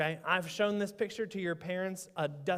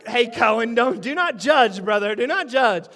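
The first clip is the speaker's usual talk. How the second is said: playful scolding; 3 semitones higher, 10 dB louder than usual. Real, not acted. Pastor to a child who reacted, playful loud scolding.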